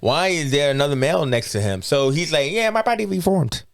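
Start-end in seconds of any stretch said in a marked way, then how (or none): 2.5-3.6 Korg voice. Retold or imitated.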